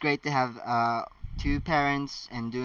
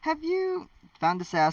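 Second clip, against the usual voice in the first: calling after. Acted. McGonagall calls after running Harry.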